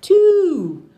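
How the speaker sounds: excited teaching. Real, not acted. Parent teaching toddler Flora the number two, high and excited. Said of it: "Flora's learning the number two".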